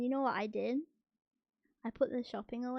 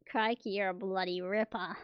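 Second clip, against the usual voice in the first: mock Australian; 6 dB louder than usual. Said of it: "What if I say".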